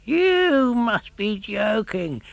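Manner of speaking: high, slow scoffing fisherman; scoffing cry. A narrator's reading. Fisherman scoffing at Pinocchio's wish to go free, slow scoff. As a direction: draw out the scoff in disbelief. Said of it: "cried the fisherman".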